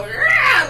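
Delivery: sudden high imitated cat yowl; cat screech. Retelling how the cat jumped out, a high screeched rah. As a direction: bark out a quick high cat cry. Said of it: "you hear a cat go".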